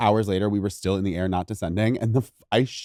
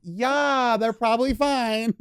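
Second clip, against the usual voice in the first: higher, slow, airy imitation; mock breezy reassurance; 10 semitones higher, much slower than usual. Imitating the vet's breezy reassurance, high and slow. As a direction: play it falsely carefree. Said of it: "I was like".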